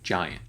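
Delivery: soft "giant", weight on GI; stress on GI. Shown as a demonstration. Songwriting teacher saying "giant" aloud to show its stress. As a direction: say "giant" with the first syllable strong. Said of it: "You can just hear it by saying it".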